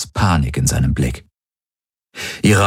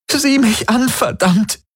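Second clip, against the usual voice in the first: desperate cry; slower than usual. Acted. Kirai demands desperately.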